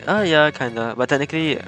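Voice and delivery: ordinary talk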